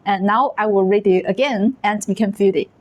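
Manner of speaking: ordinary delivery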